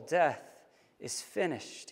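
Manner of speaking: low, soft, final murmur; hushed to himself. A narrator's reading. Dying man saying his last words to himself, hushed. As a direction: let it drop low and quiet. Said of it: "he said to himself".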